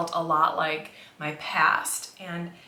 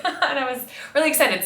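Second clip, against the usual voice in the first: laughing excitedly; 8 semitones higher, 6 dB louder, faster than usual. Real, not acted. Sharing news of the job offer.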